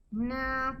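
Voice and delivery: usual speaking voice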